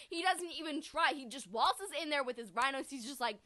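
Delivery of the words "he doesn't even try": usual speaking voice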